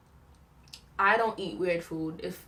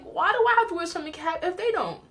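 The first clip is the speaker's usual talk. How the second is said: put-on child's whine; 9 semitones higher, faster than usual. Kid me questioning swim cap.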